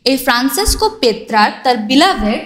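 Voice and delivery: usual speaking voice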